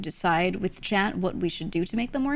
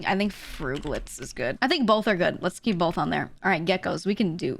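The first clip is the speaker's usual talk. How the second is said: usual speaking voice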